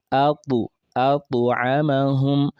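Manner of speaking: atu amahum, with a light echo vowel after the t; slow atu amahum, the t bounced with qalqala; Arabic ta with echo. Shown as a demonstration. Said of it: "I believe you are repeating after me".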